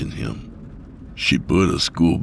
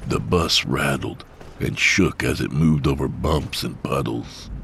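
ordinary delivery

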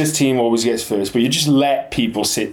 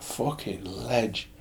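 slow, soft, admiring murmur; hushed praise for a mate; mock awed aside; 12 dB quieter, much slower than usual. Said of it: "I was like".

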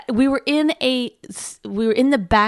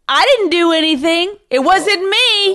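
defensive denial; 7 semitones higher, 4 dB louder than usual. Retold or imitated. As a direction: mimic someone indignantly denying blame. Mimics his defensive denial.

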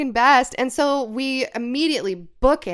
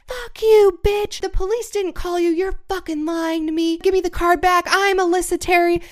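high shrill-free mock scream, much higher and louder; retold screaming; 8 semitones higher, 4 dB louder than usual. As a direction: replay her screaming fit at you, pitched way up. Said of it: "the woman just starts fucking screaming".

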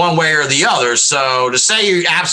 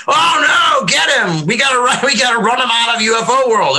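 mock panic; 8 semitones higher than usual. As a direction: yell it like a spooked mob chasing him out. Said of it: "I wouldn't be like".

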